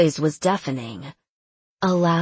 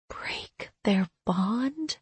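whispering; 4 semitones higher, 6 dB quieter, much slower than usual. Acted. Inner thought in shock.

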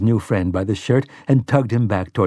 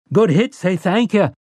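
high-pitched excited praise; high boyish congratulations; boy's cheer; 8 semitones higher than usual. A narrator's reading. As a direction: chime in with a high, eager call. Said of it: "Benny added".

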